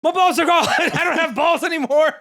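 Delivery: high, loud mock whine; hammed-up panicked confession, breaking into laughter; distressed Okran. Boy confessing his loss to her, high mock distress.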